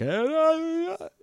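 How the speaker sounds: high wailing mock yell; re-enacting yelling as loud as possible, high-pitched; imitated panicked yelling. Storyteller re-enacting yelling for help, high mock yell. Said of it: "as loud as I can".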